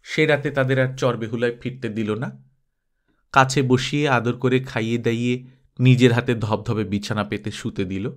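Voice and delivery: plain reading voice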